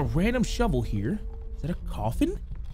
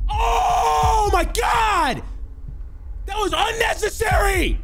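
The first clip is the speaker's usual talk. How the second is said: high, slow outraged shout; raging gamer; 13 semitones higher, slower than usual. Real, not acted. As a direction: yell it in outrage. Caught by enemy, raging.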